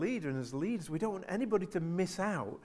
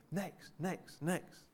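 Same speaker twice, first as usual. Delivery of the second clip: "Next, next, next" said softly and drawn out, mock bored; tired repetition of "Next"; 2 semitones higher, 7 dB quieter, much slower than usual. Shown as a demonstration. Preacher mimics the boring repetition, mock weary. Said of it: "It's boring, isn't it?".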